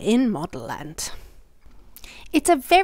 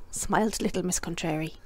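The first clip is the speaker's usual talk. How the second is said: plain reading voice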